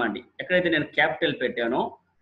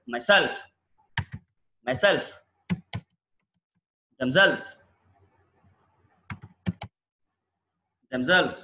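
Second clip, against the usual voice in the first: myself said as my-SELF, themselves as them-SELVES; stress on self; 3 semitones lower, much slower than usual. Shown as a demonstration. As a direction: land the push on self. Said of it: "ఇప్పుడు దీన్ని పలకండి". (Self మీద ఒత్తి)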